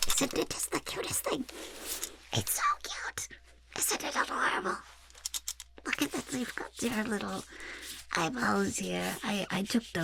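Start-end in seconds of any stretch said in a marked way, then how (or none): 0.1-6.3 high, soft squeal of adoration; gushing delight. Acted. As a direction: coo high over something adorable. Gushing over the finished portrait.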